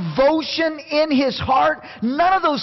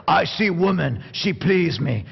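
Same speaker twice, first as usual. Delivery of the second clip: low, broken caveman grunt-speak; low caveman demanding a woman; 6 semitones lower than usual. Retold or imitated. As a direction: say it like a dim caveman. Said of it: "you almost have to say it with a caveman accent".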